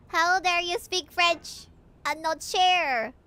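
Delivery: hammed-up indignant reproach; mock outrage. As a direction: scold them in drawn-out mock indignation. Mock scolding for not sharing.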